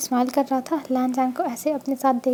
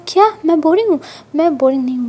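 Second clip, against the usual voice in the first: loud offended retort; indignant protest; 2 semitones higher, 7 dB louder, faster than usual. Acted. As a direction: defend yourself with playful outrage. Lanjan indignant at being called boring.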